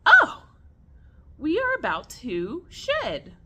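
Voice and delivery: high, loud "oh", then singsong relief; sudden realization. Retold or imitated. Owner spots blue eyes on her snake, retold sudden realization. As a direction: act out the penny dropping, pitch leaping up.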